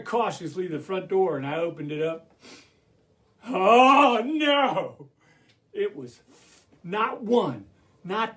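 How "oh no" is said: loud, drawn-out oh no; dismayed cry. Acted. Rabbit opening the door on the wolves, dismayed cry. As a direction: gasp it out in mock horror.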